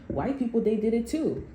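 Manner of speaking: ordinary delivery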